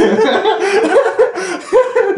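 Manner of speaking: high, loud cackling laugh; real, uncontrolled high-pitched cracking up; helpless laughter. Real, not acted. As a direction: burst out laughing and keep it going. Cracks up at the retold invitation.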